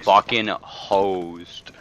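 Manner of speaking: very slow, stretched-out words; drawn out. Real, not acted. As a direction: drag every syllable out slowly. Repeats the phrase gleefully.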